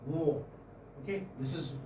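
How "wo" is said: "Wo" said low through rounded lips. Shown as a demonstration. Teacher showing the semi-vowel to students, rounded lips. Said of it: "this is pronounced with rounded lips".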